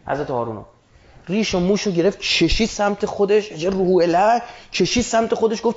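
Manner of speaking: normal talking voice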